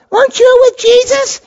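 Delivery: put-on very high child's voice; a small girl pointing him out; little girl. Little girl questions Peter at trial.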